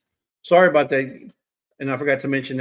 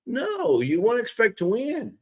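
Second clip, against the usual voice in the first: mock scolding; 8 semitones higher than usual. Real, not acted. Continues teasing scold.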